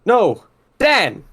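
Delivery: high, loud cry for help; yelling. Acted. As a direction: call out urgently to your friend. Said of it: "and West yells".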